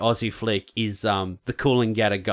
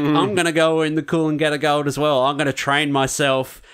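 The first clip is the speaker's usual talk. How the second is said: put-on higher and louder, fired-up kid; determined younger son; 5 semitones higher, 5 dB louder than usual. Younger son vowing to enter the race himself, fired up. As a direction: declare it like you've decided.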